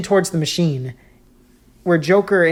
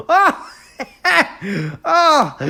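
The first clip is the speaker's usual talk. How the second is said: high, wheezing maniac laugh; hysterical laughter; 12 semitones higher than usual. Acted. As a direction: cackle out of control, wheezing. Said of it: "laughs hysterically".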